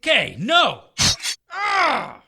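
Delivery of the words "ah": sharp high cry of pain; pain scream. Acted. Strange cut in the thigh, sharp high pain cry. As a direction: yelp as the blade cuts.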